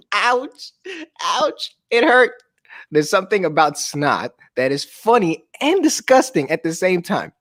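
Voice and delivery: plain reading voice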